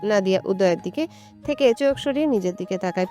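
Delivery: usual speaking voice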